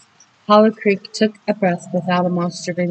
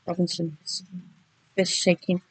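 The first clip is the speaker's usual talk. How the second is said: ordinary delivery, nothing marked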